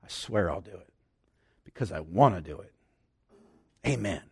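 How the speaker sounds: low, soft, earnest vow; mean every word of it, quietly; sincere oath. Retold or imitated. A blood covenant partner swearing loyalty, low sincere vow. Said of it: "this is the tone of voice".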